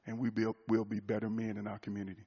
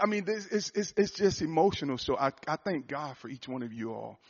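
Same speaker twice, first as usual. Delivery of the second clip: usual speaking voice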